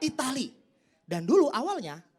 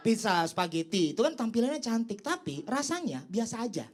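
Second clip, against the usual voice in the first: ordinary delivery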